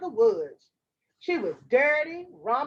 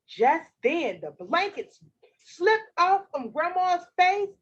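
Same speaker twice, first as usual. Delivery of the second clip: ordinary delivery, nothing marked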